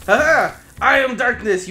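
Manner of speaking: hammed-up dark lord; villain boast. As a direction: gloat like a cartoon baddie.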